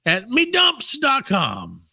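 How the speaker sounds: high, slow ad announcer; ad read. Acted. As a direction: pitch it like a cheesy ad. Ad read for sponsor.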